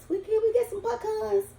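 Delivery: high-pitched imitation of kids asking; childhood quote. Cook voicing herself and friends when little, high childlike plea. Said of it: "we used to all say pecans".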